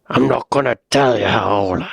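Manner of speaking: playful Bristol accent imitation. Echoing Doreen from the clip, in a Bristol accent. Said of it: "It's fun to talk like that".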